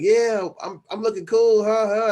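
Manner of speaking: high, loud cocky bragging; loud high boast; showing off. Retold or imitated. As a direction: strut it like the coolest kid in class. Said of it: "I'm like".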